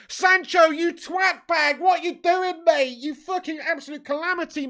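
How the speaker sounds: furious yelling. Real, not acted. Fan raging at Sancho for not passing, furious yell. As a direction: scream abuse at Sancho. Fan berates player after miss.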